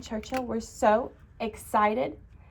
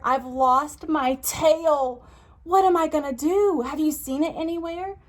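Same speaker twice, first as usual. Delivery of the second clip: worried pig voice; 6 semitones higher, 5 dB louder than usual. Acted. Pig asking Tiger about his lost tail, worried. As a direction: ask for help like a fretful little pig.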